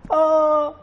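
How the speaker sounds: sudden high loud "Oh!"; mock cry at spilled coffee; startled yelp. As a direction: jump as the hot coffee spills.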